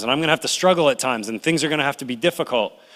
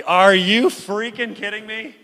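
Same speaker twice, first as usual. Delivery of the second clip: outraged Moses; 8 semitones higher, slower than usual. Retold or imitated. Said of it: "If I was Moses".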